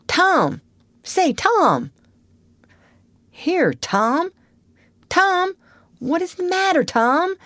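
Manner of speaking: high, worried calls; alarmed little brother shaking him awake; anxious. Acted. Sid waking his groaning brother, high anxious calls. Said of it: "looked in his face anxiously".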